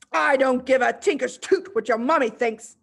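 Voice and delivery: loud, scornful yell. Acted. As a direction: yell it back at the girl. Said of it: "the Trunchbull yelled".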